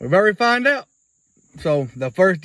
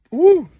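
loud pained whoop; 11 semitones higher, 7 dB louder than usual. Real, not acted. Mouth burning.

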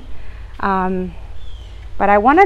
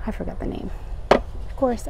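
whispered aside; 2 semitones higher, 9 dB quieter than usual. Real, not acted. Muttering to herself, forgot name.

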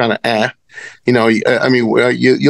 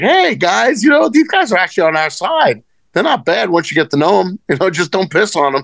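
much higher, eager, hammed-up discovery; mock excited announcement; 7 semitones higher than usual. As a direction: proudly share a silly eureka. Announcing his bright idea.

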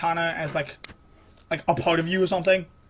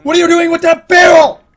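high, full shouted question; incredulous yell; 9 semitones higher, 12 dB louder than usual. Real, not acted. Player yelling at the climber over a barrel, incredulous. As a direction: yell it in outraged disbelief.

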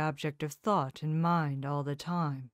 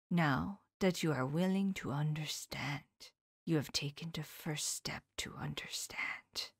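Van Helsing; 4 dB quieter than usual. Acted. Old professor whispering his lesson to his pupil, hushed. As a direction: confide each truth in a near whisper.

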